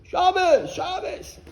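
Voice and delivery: high, loud shout; demonstrated high-pitched yell; scream. Retold or imitated. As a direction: belt it out for the whole street.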